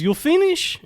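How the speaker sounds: lightly voiced Russian woman asking; a hint of an accented woman's question; imitating the woman. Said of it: "she goes".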